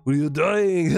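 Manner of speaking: hammed-up Arnold yelling at the boxer; Arnold Schwarzenegger impression. Streamer imitating Arnold yelling at Keith, lightly done Austrian accent. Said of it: "do them Arnold Schwarzenegger".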